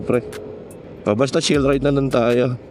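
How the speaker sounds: usual speaking voice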